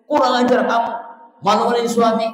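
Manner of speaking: husband scolding his wife, in a mock furious shout; angry husband. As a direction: bark at the wife like an enraged husband. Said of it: "Tempeleng tuh istri".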